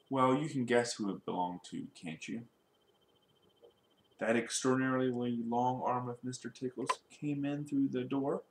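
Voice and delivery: ordinary delivery, nothing marked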